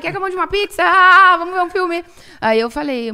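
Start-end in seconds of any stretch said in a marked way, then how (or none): 0.0-2.0 high, loud, breezy mother brushing it off; chirpy mom voice. Retold or imitated. Mom answering her daughter's coming out, high breezy voice. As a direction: play the mom as if it's no big deal, bright and high.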